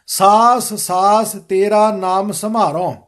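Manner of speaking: slow, measured recitation of scripture; careful model reading. Shown as a demonstration. Teacher modelling the shabad for students, slow careful recitation. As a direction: read it out slowly, every word distinct. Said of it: "ਸ਼ਬਦ ਸ਼ੁੱਧ ਕਰਵਾਵਾਂਗਾ".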